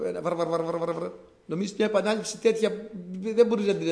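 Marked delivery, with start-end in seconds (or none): 0.1-1.1 repeated bar bar bar, mimicking unintelligible speech; mock βαρ βαρ βαρ gibberish; imitating foreign babble. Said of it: "κάνε ένα, μια".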